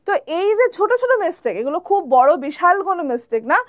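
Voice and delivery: usual speaking voice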